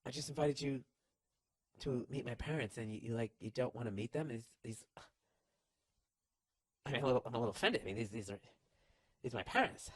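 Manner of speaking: soft, hurt and confused; quietly taking offence; hurt girlfriend. Retold or imitated. Girlfriend quietly hurt that boyfriend won't meet parents. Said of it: "And then you'd be like".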